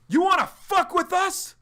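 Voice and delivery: high, loud enraged threat; furious yell. Retold or imitated. Blake threatening the attackers, a high furious yell. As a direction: scream the threat at them.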